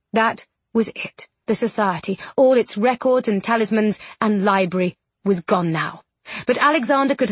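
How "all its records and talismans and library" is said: usual speaking voice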